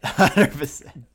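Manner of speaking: chuckling through the words; amused agreement, slow and laughing. Real, not acted.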